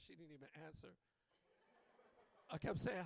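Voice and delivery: normal talking voice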